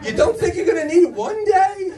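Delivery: a high, put-on mom voice scolding her son. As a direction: squeak it out like a fussing mom. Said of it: "My mother's like".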